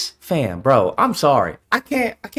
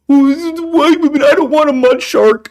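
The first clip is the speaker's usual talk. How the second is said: mocking quote; 4 semitones higher than usual. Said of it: "What do you think they say?".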